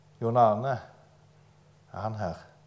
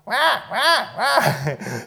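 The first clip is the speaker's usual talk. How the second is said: high, loud mock baby cries; imitated baby wail; 11 semitones higher, 8 dB louder than usual. Preacher showing an unweaned baby waking parents, mock wailing. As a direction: wail like a hungry baby in short bursts.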